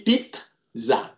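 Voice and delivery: "pizza" said pit-za, with a crisp T; clear T in pizza. Shown as a demonstration. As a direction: tap the T in "pizza". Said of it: "Remember the T".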